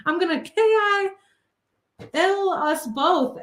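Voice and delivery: high, frantic yell at the driver; frantic screaming. Retold or imitated. Kidnapped woman shrieking at the driver to pull over. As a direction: scream it, demanding he stop now. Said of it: "really just going crazy and saying".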